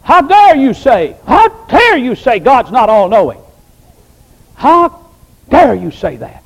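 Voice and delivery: loud, slow, indignant "How dare you", rising high on "How"; mock outrage. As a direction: imitate a riled-up believer, hammed up. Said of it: "we get all riled up".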